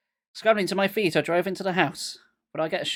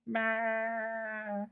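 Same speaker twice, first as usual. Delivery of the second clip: high frantic pleading; panicked; 8 semitones higher than usual. A narrator's reading. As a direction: beg in panic. Panicked plea.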